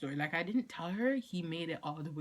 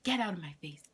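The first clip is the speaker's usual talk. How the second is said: mock-mom order, moderately raised voice; imitating angry mom; 3 semitones higher, 4 dB louder, faster than usual. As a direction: send them off like a fed-up mother. Mom dismissing her, retold.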